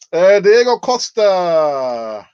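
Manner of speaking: drawn out slow and loud, falling on Diego; dramatic announcement. Acted. Fan revealing the dreaded name to viewers, slow and loud. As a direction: unveil the name like a big reveal.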